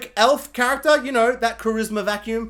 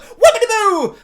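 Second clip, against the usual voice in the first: much higher, much louder playful whoop; mock whoopee; 13 semitones higher, 9 dB louder than usual. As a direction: whoop it out mid-flip. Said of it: "he's all like".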